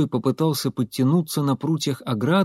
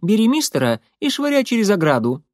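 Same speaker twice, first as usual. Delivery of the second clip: high-pitched, brisk character order; commanding Molly; 8 semitones higher, 3 dB louder than usual. A narrator's reading. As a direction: issue the order in Molly's raised voice. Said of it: "приказала она".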